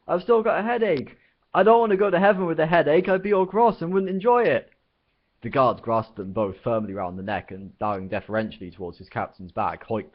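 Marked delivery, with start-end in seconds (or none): 0.1-4.7 high, loud, panicky yell. Acted. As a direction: scream it in terror. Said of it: "he yelled".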